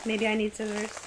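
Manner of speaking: ordinary talk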